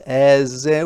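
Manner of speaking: ordinary talk, nothing marked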